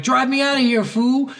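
mock urgent order. Gang member to his driver after a beating, mock urgent order. Game character demands getaway.

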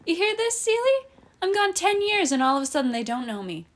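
high, loud, mocking scoff. Eddie scoffs to Celia about not being recognized, high and loud. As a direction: scoff in outrage to the room. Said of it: "he scoffed".

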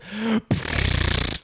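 high gasping shock; loud shocked gasp. Acted. Whole family gasping as the gun comes out, shocked gasp. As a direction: gasp in horror. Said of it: "Everybody say".

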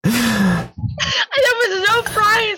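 high, squeaky words through laughter; laughing almost to tears; helpless laughing. Real, not acted. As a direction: barely get it out for laughing. Cracks up explaining the joke.

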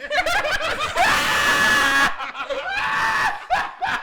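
a loud falsetto laugh-scream; howling with laughter; loud high laugh. Real, not acted. Group cracks up.

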